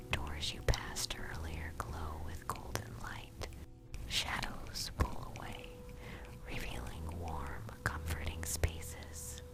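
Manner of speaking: ordinary delivery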